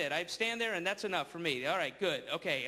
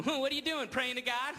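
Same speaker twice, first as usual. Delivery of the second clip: mock taunting churchgoer; 8 semitones higher than usual. Mocking churchgoers.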